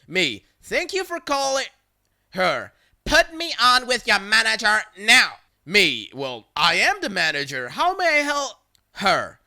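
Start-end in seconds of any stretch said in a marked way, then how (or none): 0.6-1.7 high chirpy phone greeting; customer-service voice. Retold or imitated. 3.1-5.4 loud demanding caller; demanding Karen. Retold or imitated. Angry caller demands manager. 6.1-8.6 sweet service voice masking annoyance; polite manager. Retold or imitated. Manager answers rude caller.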